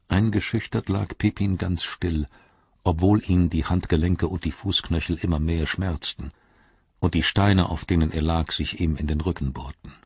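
usual speaking voice

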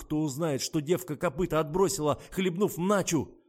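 high, loud agitated rant; panicked anger. A narrator's reading. Adman fears the scandal getting out, agitated.

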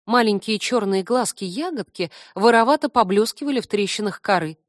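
ordinary delivery, nothing marked

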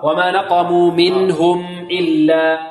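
slow recitation, meem of منهم held before إلا; measured meem. Shown as a demonstration. Teacher modelling for students, measured meem. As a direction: chant منهم إلا with a clear closed m. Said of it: "نعطي الميم قدر من التوسط".

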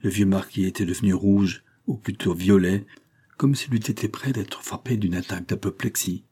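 usual speaking voice

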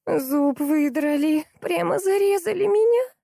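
very high, slow, drawn-out whine; whining wail. A narrator's reading. Salesgirl complains of her pulled tooth, whining high. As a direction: moan on a held high note, rising. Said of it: "заныла девушка".

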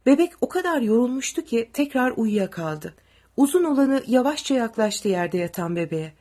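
plain reading voice

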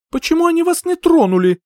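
high, loud accusing demand; confrontational. A narrator's reading. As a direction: round on them in shrieked indignation. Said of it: "С наездом подскочила к мужчинам".

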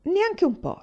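high, loud bravado; swaggering. A narrator's reading. Carl boasting to Una in the graveyard, swaggering. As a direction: brush off the fear with a boast. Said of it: "rispose lui spavaldo".

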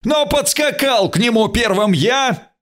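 high, strained narrated shout; insistent yell held high in pitch; outshouting everyone. A narrator's reading. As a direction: cry out over the rivals. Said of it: "закричал громче всех".

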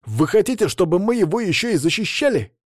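high, incredulous protest; indignant question. Acted. Возмущение подопечных приказом.

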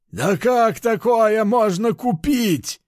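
high, slow, incredulous cry; can't believe it, voice up high; disbelieving. Acted. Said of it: "не верил кабан".

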